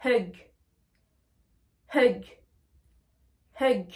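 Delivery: Hug with a French "eu" vowel; isolated Hug, each with an uh vowel. Shown as a demonstration. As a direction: model Hug, not hog, with long pauses. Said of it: "comme si c'était un E et un U".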